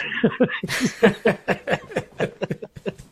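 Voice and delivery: long burst of real laughter; helpless laugh after the punchline. Real, not acted. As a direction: crack up at your own impression. Cracks up after own impression.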